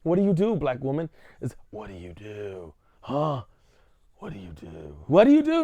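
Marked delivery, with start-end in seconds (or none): 1.4-5.0 hushed repeat. Acted. Repeated question dropped to near silence. 5.1-5.6 high insistent repeat. Acted. Repeated question suddenly pushed high.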